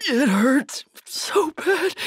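high, slow tearful agony; pained cry. Acted. Wounded survivor alone and hurting, pained high cry. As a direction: drag the words out through pain. Injured, fears dying today.

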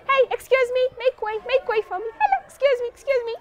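put-on boy pushing through to the front; small boy. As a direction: squeeze politely through the crowd. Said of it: "he said".

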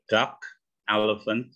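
ordinary delivery

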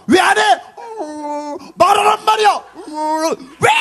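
high, loud exasperated demands; mock scolding mother. Mother ordering her baby to speak, high exasperated yelling. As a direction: shout in hammed-up frustration at a baby.

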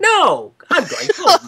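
high, loud mock yell; hammed-up squealed protest; acted refusal. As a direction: shriek a comic refusal. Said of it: "And he's just like".

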